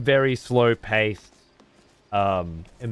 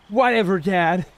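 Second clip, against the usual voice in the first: a hint of a bratty teen, higher and louder; American teen; 7 semitones higher, 4 dB louder than usual. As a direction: toss it off like a sulky American teen. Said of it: "Like the American teen in God of War".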